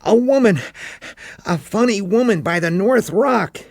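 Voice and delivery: put-on a kid bursting to tell; excited boy. A narrator's reading. Boy runs up gasping.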